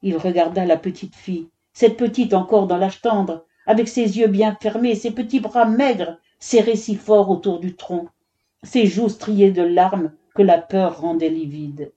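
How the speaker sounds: ordinary delivery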